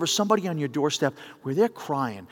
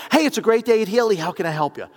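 raised, bright-hearted greeting, imitated; chirpy phone receptionist; 7 semitones higher, 6 dB louder than usual. Receptionist picking up his call, mock chirpy greeting. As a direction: launch the scripted greeting high and loud. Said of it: "she gets on the phone and she says".

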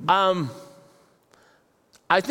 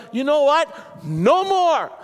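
firm resolve; 6 semitones higher, 3 dB louder, slower than usual. Pretend. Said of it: "you just need to be like".

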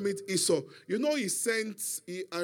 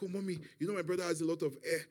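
afraid; 2 semitones lower, 8 dB quieter, faster than usual. Retold or imitated. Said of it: "Jacob was afraid".

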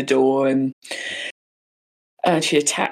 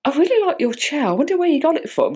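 imitating high-pitched, polite small talk; 12 semitones higher, faster than usual. Killer chats about the chair afterwards, politely. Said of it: "quite politely just kinda goes".

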